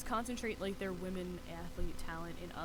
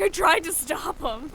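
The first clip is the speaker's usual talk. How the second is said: loud, drawn-out apologetic wail; loud sheepish brother; 8 semitones higher, 12 dB louder, slower than usual. Retold or imitated. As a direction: belt it out like a guilty brother. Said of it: "and my brother's like".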